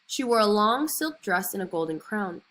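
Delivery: plain reading voice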